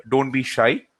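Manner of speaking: usual speaking voice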